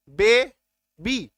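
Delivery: "Baby" very slow and loud, बे... बी; teacher counting out both syllables of "baby". Shown as a demonstration. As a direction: break "baby" into two separate, stretched beats. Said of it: "दो बार बोला ना".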